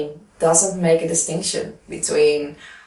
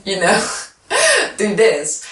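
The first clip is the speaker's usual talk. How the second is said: laughing; 3 semitones higher than usual. Shown as a demonstration. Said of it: "Everybody is able to just".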